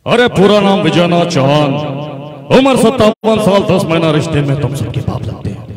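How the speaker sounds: booming, high-pitched film-hero declaration; Amitabh Bachchan. Acted. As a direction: thunder it out like a movie legend introducing himself. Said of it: "ये mimicry big B की करना चाहूंगा".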